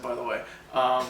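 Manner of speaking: ordinary delivery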